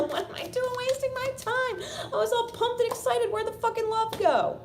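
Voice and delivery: very high, loud, mock-outraged squeal; hammed-up exasperation. Disappointed lover who was all pumped and excited, exasperated. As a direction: wail it comically high, at full tilt. Hammed-up frustration after laughing.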